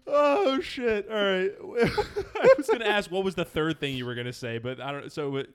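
loud, breathless laughing reaction; after laughing hard. Real, not acted. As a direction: still cracking up, loud.

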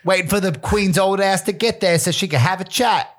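put-on high, cheeky British accent. Imagining Princess Di waiting in heaven, British accent. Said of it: "I can't do accents like that".

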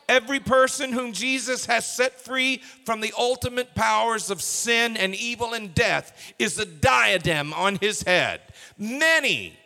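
loud, soaring sermon climax; preaching at full force. Acted.

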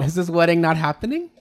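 high incredulous question, half laughing; mock alarm. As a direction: ask in playful panic. Acted worry about the wedding.

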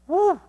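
high, loud happy whoop; joyful woo. Real, not acted. Preacher whooping for joy at being renewed.

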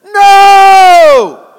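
sudden explosive high shout; preacher voicing Paul's flat refusal at full volume. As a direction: bellow the refusal. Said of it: "And Paul said".

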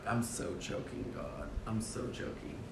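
defeated half-whisper to God; hushed self-reproach. Retold or imitated. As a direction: whisper it slowly, owning up to failure. Retold ashamed inner confession to God.

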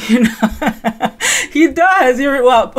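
words laughed out; real amused laugh. Real, not acted. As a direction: laugh through he does. Laughing at own comparison.

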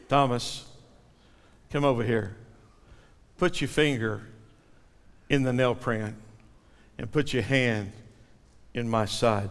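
soft and low, calling Thomas close gently; a quiet, tender invitation; tender voice of Jesus. Acted. Jesus gently inviting doubting Thomas to touch, tender and soft.

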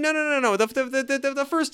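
incredulous correction; exasperated disbelief. Real, not acted.

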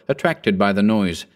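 plain reading voice

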